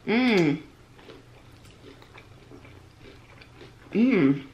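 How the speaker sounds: muffled closed-mouth hums; talking while chewing food; mouth full, chewing. Real, not acted. Seen 0: Bites egg roll at -1, chews with closed mouth through 6.7.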